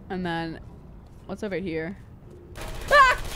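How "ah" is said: high, loud sudden yelp; startled yelp. Real, not acted. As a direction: yelp at the turrets. Spots turrets suddenly.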